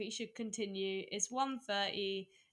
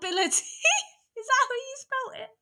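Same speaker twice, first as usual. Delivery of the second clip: high, loud laughing through words; crying with laughter; 13 semitones higher, 10 dB louder, much faster than usual. Real, not acted. Laughing at partner's spelling.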